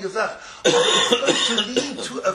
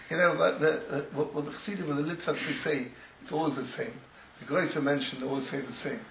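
ordinary delivery

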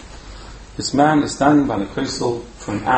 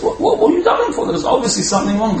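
insistent question; 9 semitones higher, faster than usual. Acted. Pressing the crying man.

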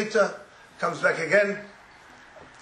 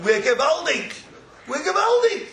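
triumphant exclaim; 10 semitones higher, 5 dB louder than usual. Acted. Triumphant contrast, rising.

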